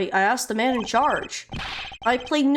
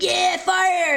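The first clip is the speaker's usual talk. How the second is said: mock cheer; 6 semitones higher, 3 dB louder than usual. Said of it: "You don't be like".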